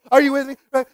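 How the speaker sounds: normal talking voice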